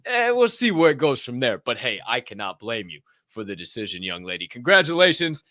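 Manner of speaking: ordinary talk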